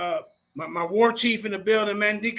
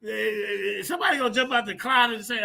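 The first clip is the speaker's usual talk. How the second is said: ordinary talk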